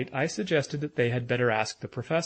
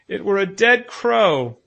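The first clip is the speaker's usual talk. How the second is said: put-on high, loud, slow stubborn child; persistent insistence; 8 semitones higher, 10 dB louder than usual. A narrator's reading. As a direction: insist on it like a sulky boy. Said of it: "Bruno persisted".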